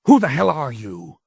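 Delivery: angry shout; raging shout. A narrator's reading. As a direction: fling it at the creature in rage. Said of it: "in a rage".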